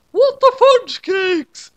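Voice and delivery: high, slow, loud shriek; screaming. A narrator's reading. Light's mom screaming at the risen clones. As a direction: shriek it out in outrage. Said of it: "screamed Light's mom".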